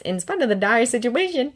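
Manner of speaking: plain reading voice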